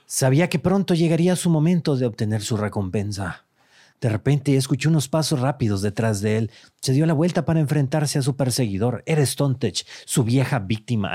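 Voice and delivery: dramatic storytelling read; story narration. Acted. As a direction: narrate it like a dark tale. Reads AI story aloud.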